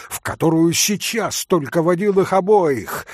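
usual speaking voice

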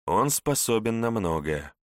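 ordinary delivery

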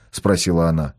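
ordinary delivery, nothing marked

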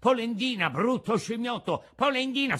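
loud, very high name-calling; taunting. A narrator's reading. Two old men trade insults, high loud jeering. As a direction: hurl nicknames in a high character voice.

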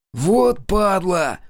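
slow furious swear; frustrated curse. A narrator's reading. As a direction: spit out in anger at missing. Policeman misses fleeing boy.

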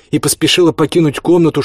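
ordinary delivery, nothing marked

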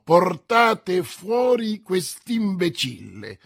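a cardinal's cold slow command; ordered. A narrator's reading. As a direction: bark the order with disdain. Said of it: "ordinò il cardinale".

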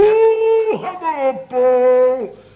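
high, loud horrified yelp; oh no, don't remind me; aghast exclamation. Real, not acted. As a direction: shriek off the bad memory. Recoiling from awful memory.

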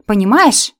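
loud shrieking elf; voice breaking into a squeal. Acted. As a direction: let the voice crack into a squeal. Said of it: "Голос эльфа сорвался на визг".

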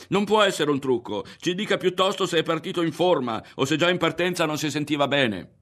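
excited shouted questioning; crowd shouting. A narrator's reading. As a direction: press the point loudly over others. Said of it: "gridavano altri".

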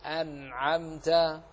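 أنعمت with a light, short noon, no echo; correct noon. Shown as a demonstration. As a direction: say أنعمت slowly, noon neither bounced nor held. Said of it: "فننطق هكذا".

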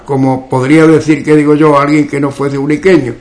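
normal talking voice

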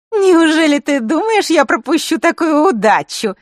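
put-on high, snide smiling girl's voice; mock him with a sly smile. A narrator's reading. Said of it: "ехидно улыбаясь".

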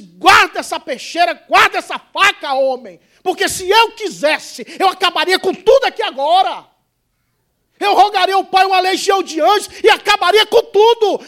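loud, rising command building to a shout; Jesus commanding. Retold or imitated. Jesus commands Peter to put away the knife, building to a shout. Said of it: "Jesus disse".

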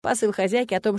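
ordinary delivery, nothing marked

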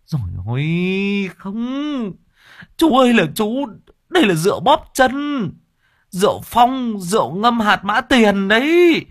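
high, loud desperate explaining; frantic pleading. Acted. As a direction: beg the uncles to throw up. Nephew pleading, explaining poison. (van nài cuống quýt, cao và to)

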